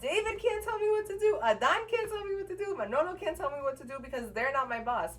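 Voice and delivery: high, loud, rattled-off defiance; retold heated retort. Retold heated argument, shouting back.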